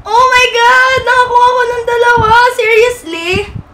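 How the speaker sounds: high, loud wail; shrieking fangirl amazement; ecstatic disbelief. Real, not acted. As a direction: cry out, stretching the last word. Still stunned by two cards.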